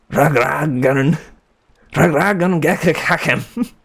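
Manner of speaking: high, slow mock German accent. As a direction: spit out the name in a hammed-up German accent. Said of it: "say my name in a German accent".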